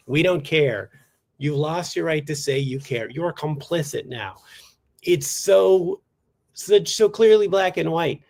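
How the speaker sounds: usual speaking voice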